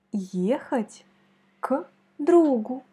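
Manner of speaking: Ехать with the accent on the first Е; stress on Ехать. Shown as a demonstration. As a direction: hit the first vowel of Ехать. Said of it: "C'est la première voyelle Y qui est frappée". (accent sur le premier е de Ехать)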